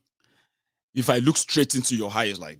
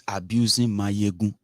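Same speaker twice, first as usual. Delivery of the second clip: ordinary talk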